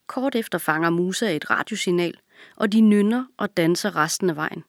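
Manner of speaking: ordinary delivery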